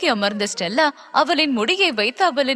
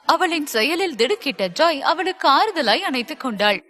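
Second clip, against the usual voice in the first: plain reading voice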